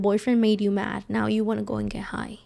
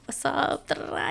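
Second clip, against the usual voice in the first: higher, chattery self-imitation; super talkative; 5 semitones higher, 6 dB quieter than usual. Retelling being high around mom, super talkative. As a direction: babble away like your chatty self. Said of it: "I would be super talkative".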